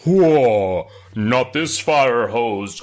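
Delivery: big drawn-out cartoon cry; exaggerated action-scene voice; mock alarm. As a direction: bellow it like a lumbering giant. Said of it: "He's like".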